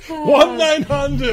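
mock hotline ad. Hotline ad parody.

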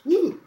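sudden high loud yelp; burning yelp. Retold or imitated. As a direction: yelp as the heat hits. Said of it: "and then it goes".

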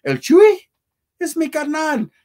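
high, loud burst of surprise; excited recognition. Real, not acted. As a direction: yelp his name like you just spotted him.

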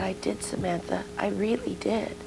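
put-on quiet, earnest mother to child; soft reassurance. Mother reassuring her young daughter.

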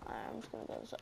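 barely voiced, very soft aside; muttered to self. Real, not acted. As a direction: mutter it almost silently. Sneaking around the game map.

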